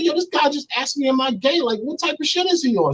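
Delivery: high-pitched, retold outrage; acted indignation, pitch way up; indignant complaint. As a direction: act out an offended complaint to a third person. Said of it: "So I got angry".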